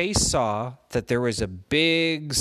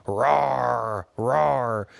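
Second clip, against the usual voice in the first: lion roar; 3 dB louder than usual. Acted. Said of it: "that's how lions speak in lion language".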